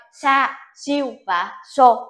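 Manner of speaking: "Sa, siêu và so" read slowly, syllable by syllable, without the y glide; slow, careful reading demo; dropping the y sound. Shown as a demonstration. Teacher to students, showing the reading without y, slow careful demo. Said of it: "bỏ chữ y đi".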